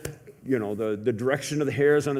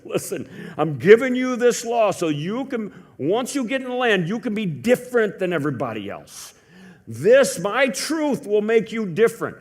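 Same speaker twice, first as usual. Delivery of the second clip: God speaking the law, a touch higher and firmer; preaching God's voice; 4 semitones higher, 4 dB louder than usual. Acted. God tells Moses and Aaron on the mountain, firm proclaiming. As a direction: proclaim it as the divine lawgiver.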